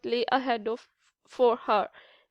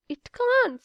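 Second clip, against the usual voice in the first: emphatic; 11 semitones higher than usual. Acted. Anna refuses firmly.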